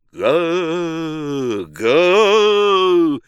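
long rising roar of a crowd; swelling drawn-out "ga". A narrator's reading. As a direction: roar like a stirred mob. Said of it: "зашумела толпа".

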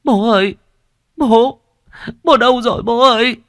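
high, loud crying calls for father; sobbing. Acted. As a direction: wail for the lost father. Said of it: "Duyên nức nở".

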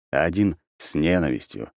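plain reading voice

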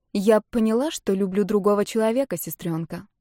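ordinary delivery, nothing marked

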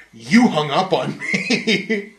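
slow, drawn-out mocking whine with a laugh; mock accusing customer. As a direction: stretch out each word like the annoying caller.

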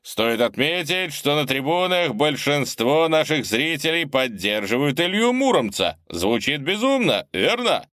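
broadcast it to the whole stadium; booming announcer. Acted. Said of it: "вещал Олег Маряшин".

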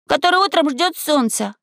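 put-on high-pitched boyish voice; high, clear young voice; ringing recital. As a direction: carry the line on high and ringing. Boy continues his ringing question.

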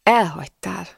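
toneless, drained accusation; colourless. A narrator's reading. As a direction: say it with no colour at all. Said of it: "mondta színtelenül". (színtelen, kifejezéstelen hang)

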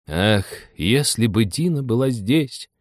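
usual speaking voice

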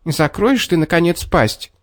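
high, exasperated exclamation; indignant fox. A narrator's reading. Fox has had enough of the bear, indignant. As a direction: protest in a high voice. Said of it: "возмутилась лисица".

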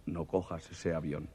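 a mysterious voice warns him off the train; ominous voice. Acted. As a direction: drop the voice like a ghostly warning.

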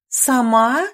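shocked high exclamation; scandalised lady exclaiming. A narrator's reading. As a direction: gasp it out in disbelief. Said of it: "снова воскликнула".

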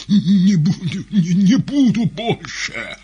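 very loud, high croaking wail; hoarse weeping plea. A narrator's reading. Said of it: "прохрипела голова".

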